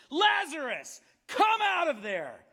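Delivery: loud, slow, high calling out. A narrator's reading. Jesus calling Lazarus from the tomb, loud slow shout. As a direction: call him out of the tomb. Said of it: "called out in a loud voice".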